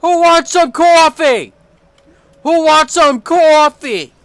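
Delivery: high, loud sing-song holler; loud call. Real, not acted. Calling loudly to anyone who wants coffee. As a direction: belt it out like calling the whole house.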